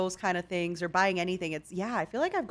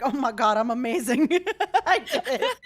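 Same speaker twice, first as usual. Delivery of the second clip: mock triumph; 8 semitones higher, 5 dB louder than usual. Acting out triumph after fix works.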